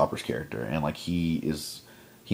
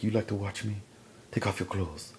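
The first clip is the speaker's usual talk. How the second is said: acted soft command; 3 semitones higher, 7 dB quieter than usual. Woman toying with the man she caught, hushed. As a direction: say it quietly, as a come-on.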